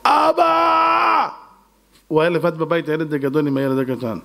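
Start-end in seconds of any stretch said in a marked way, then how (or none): 0.0-1.3 high, drawn-out crying cry for father; a panicked child wailing for dad; son screaming. Acted. Older son screams for his father, crying and panicked. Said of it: "הבן הגדול שלו צועק".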